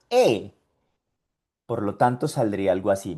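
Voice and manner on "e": "E" with rounded lips, O-shaped mouth; E sound. Shown as a demonstration. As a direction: say "E" through an O mouth. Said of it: "va a salir el sonido".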